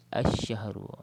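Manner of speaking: "Asyahru" with doubled sh and no L; silent lam. Shown as a demonstration. Teacher repeating the example, "Asyahru" with silent L. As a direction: go from A straight into sh in "Asyahru". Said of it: "jadi kita tak baca Al-Syahru".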